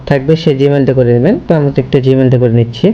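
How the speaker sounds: ordinary talk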